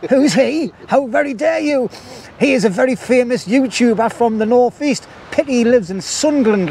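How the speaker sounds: high, hammed-up indignation; mock outrage. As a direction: act deeply affronted, pitched right up.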